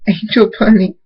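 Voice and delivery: high, tearful cry of a name; a sobbing wail. A narrator's reading. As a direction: call her name through tears. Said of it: "drops to her knees crying and says".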